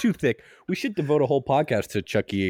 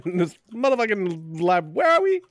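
tough-cop rant; 6 semitones higher than usual. Acted. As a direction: play a lost movie cop. Joining the cop impression bit.

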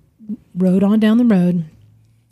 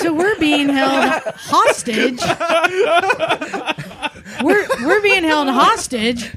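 laughing; 8 semitones higher than usual. Real, not acted. Laughing about being held hostage.

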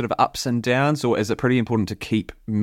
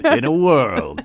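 booming trailer narrator, louder; movie trailer voice; 5 dB louder, slower than usual. Pretend. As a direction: announce it like an epic film trailer. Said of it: "like, movie trailer music playing underneath it".

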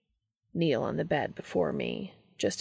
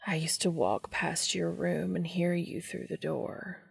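softer, half-whispered confession; intimate whisper; 5 dB quieter than usual. A narrator's reading. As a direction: breathe the memory into his ear.

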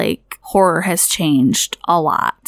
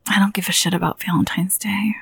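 muttered aside; 5 dB quieter, faster than usual. Real, not acted. Muttered aside about Valentine's Day.

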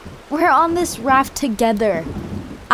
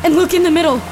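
raised, urgent warning; alarmed; 5 semitones higher than usual. Acted. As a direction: call out the danger ahead. Spots danger ahead on river.

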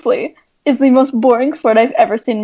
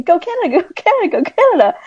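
chanted encouragement, slightly higher; mock cheering; 6 semitones higher, faster than usual. Recreating her cheering for the Canadian skier, playful chant. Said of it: "give us a recreation of your cheering".

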